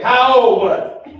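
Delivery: loud high elder's question, half laughing; probing grandmother. Retold or imitated. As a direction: press the child for details. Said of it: "She said".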